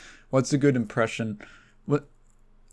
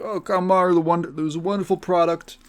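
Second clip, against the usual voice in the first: higher, showy salesman voice; Trump voice; 5 semitones higher than usual. Acted. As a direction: sell the product like a bragging politician. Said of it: "He always goes like".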